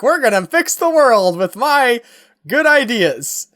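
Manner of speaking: mock-naive optimist, good ideas stretched out slowly; happy-go-lucky idealist. Mocking President Wilson's plan to fix the world, happy-go-lucky. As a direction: parody a cheerful do-gooder with big plans.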